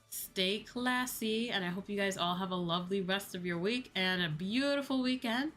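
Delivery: ordinary delivery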